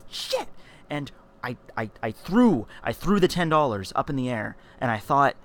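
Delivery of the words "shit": high startled yelp; caught-out squeak; panicked yelp. Retold or imitated. Caught sneaking, panicked exclamation.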